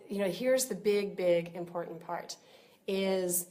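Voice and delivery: ordinary talk, nothing marked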